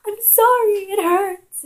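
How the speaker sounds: very high, loud mock sobbing wail; imitated crying scream. Child pleading with uncle after being popped, high screaming mock cry. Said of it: "crying and screaming".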